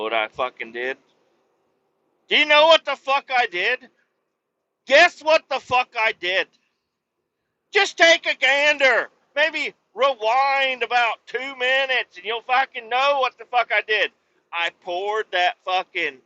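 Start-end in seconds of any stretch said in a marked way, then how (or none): 2.3-3.9 higher and louder repeat of the question; worked-up storyteller forcing the punchline question; loud demand. Real, not acted. 4.9-14.1 higher and louder, pressing on; worked-up rant. Real, not acted. Keeps pressing the listener.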